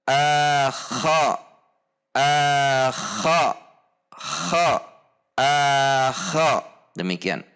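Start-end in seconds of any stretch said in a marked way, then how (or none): 0.1-6.5 "akha" with the a unchanged before and after kh; steady unrounded kha. Shown as a demonstration. Teacher showing "akha" with no change in the a. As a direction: keep the a open on "akha".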